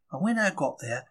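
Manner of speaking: usual speaking voice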